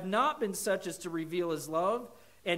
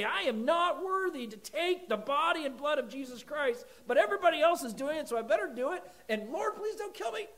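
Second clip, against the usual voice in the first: put-on higher, rushing, anxious kid's prayer; childhood fearful prayer; 10 semitones higher, 5 dB louder than usual. As a scared kid praying at communion, nervous and rushed. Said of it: "And I'd say".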